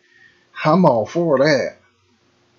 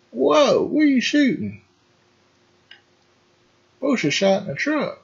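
startled whoa; 13 semitones higher than usual. Real, not acted. Reacting to something on screen.

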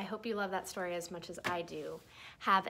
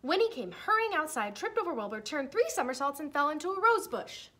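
excited; 9 semitones higher, 7 dB louder, faster than usual. Acted. Winnie's tumble, building excitement.

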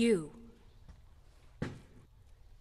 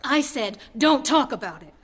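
angry warning; 3 semitones higher, 6 dB louder than usual. Acted. Warning him off a painful memory.

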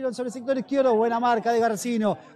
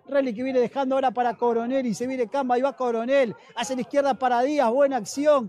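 ordinary talk

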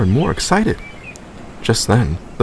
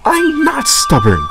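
much higher, slow offended protest; indignant; 4 dB louder, slower than usual. A narrator's reading. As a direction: deny it hotly. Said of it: "puffed Thomas indignantly".

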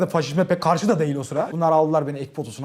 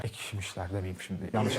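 muttered aside; 11 semitones lower, 15 dB quieter than usual. Real, not acted. Aside correcting himself.

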